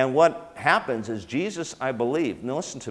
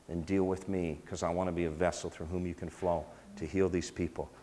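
soft, hushed prayer, much quieter; 3 semitones lower, 7 dB quieter than usual. Shown as a demonstration. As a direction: pray it softly and intimately. Prays quietly as a demo.

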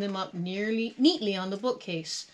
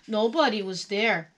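ordinary delivery

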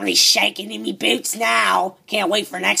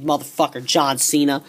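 ordinary talk

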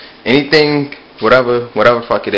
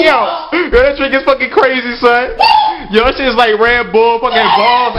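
ordinary talk, nothing marked

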